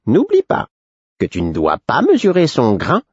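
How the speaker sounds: sly, riddling fool's counsel. A narrator's reading. The Fool's parting advice.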